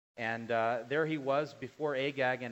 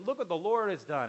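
higher, louder boastful cheer; jovial and happy; 6 semitones higher, 6 dB louder than usual. Acted. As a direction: proclaim it happily, like a pleased king. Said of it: "Saul came back all jovial and happy".

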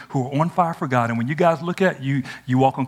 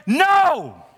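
a sudden high, loud "No!"; defiant no; 6 dB louder than usual. Acted. Student refusing the culture, shouted high.

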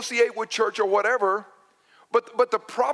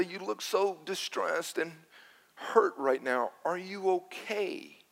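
gentle concern; 7 dB quieter than usual. Retold or imitated. Comforting a crying stranger.

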